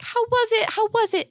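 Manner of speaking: high, eager mock family voice; mimicking family. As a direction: imitate the family asking over and over. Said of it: "all of my family members would be like".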